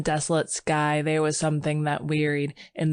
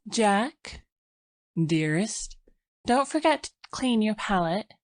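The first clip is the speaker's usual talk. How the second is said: put-on lightly raised, gentle young wife voice; young woman; 6 semitones higher than usual. Sylvia's voice, higher.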